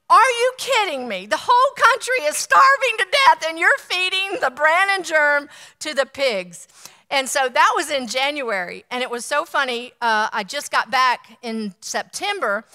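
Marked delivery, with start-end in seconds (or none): 0.1-6.7 higher and louder retold outrage at the waste; outraged disbelief. Telling the young man in the market, retold outraged disbelief. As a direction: let the indignation burst out, half-acted.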